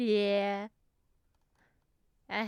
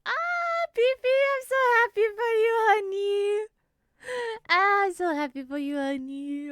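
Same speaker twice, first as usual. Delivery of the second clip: very high, loud gushing, easing down at the end; overjoyed squeal; 11 semitones higher, 8 dB louder than usual. Real, not acted. So happy for Beefy's news, overjoyed squeal. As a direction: squeal with joy for a dear friend.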